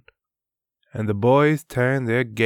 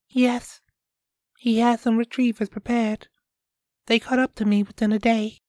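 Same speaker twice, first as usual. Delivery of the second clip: put-on higher, quick young girl; a girl explaining her parents' fears, higher voice; 14 semitones higher, faster than usual. Piola voiced in story.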